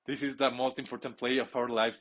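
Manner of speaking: ordinary talk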